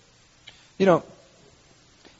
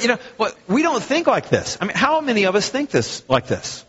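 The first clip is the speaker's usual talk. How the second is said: ordinary delivery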